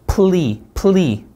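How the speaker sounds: "plea" with a clear l; L sound. Shown as a demonstration. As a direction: punch out "plea" with a firm l. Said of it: "받침 있는 리을".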